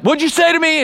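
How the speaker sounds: sudden high, jumped-up "what'd you say to me"; a touchy friend squaring up for a fight; hothead challenge. Retold or imitated. A preacher mimics a quick-tempered friend, jumped-up and high.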